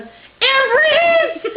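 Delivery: high, loud holler; retold yell. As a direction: holler it out of the car. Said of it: "Jen yells out".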